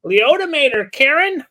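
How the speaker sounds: high, loud excited joke. Real, not acted. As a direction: shout it with glee. Excited joke about Liotta.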